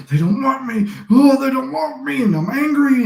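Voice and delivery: high, whiny mock of a frustrated guy; mocking a friend spiralling after rejection, high and whiny; whiny rejected friend. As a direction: imitate the friend sulking that nobody wants him. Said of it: "he would be like".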